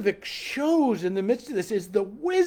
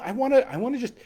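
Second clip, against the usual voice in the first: ordinary delivery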